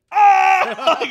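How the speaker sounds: sudden high, loud mock wail; retold wail. Little brother after falling off the bunk, imitated howl. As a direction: let out a child's hurt howl. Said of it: "started crying".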